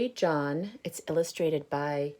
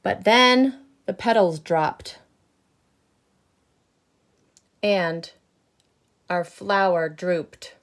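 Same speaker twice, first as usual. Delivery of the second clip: loud, higher, grumbling seed voice; grumpy bad seed; 4 semitones higher, 10 dB louder than usual. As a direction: boast about being bad, loud. Seed tells his backstory.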